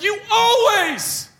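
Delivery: "You always" pitched high and drawn out; mock heated accusation at the spouse; quarrel quote. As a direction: replay the row, stretching "always" up high. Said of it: "I say stuff like".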